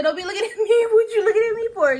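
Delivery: loud, high, mock-outraged telling-off; acting out a loud "quit staring"; indignant scolding. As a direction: fire it off fast and high, half laughing.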